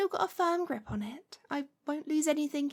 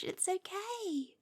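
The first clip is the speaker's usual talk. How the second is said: hushed, intimate witch speaking close to the ear; gentle hypnotic murmur to a new familiar. Acted. Soft roleplay voice throughout.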